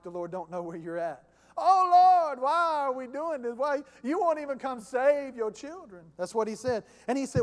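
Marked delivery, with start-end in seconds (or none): 1.6-6.0 loud, high mock wail to God; whiny complaint. Preacher mimicking Habakkuk blaming the Lord, whiny. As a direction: whine accusingly, bursting out on the first words. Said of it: "go to the Lord whiny".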